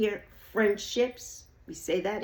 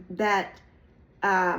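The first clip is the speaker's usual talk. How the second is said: ordinary delivery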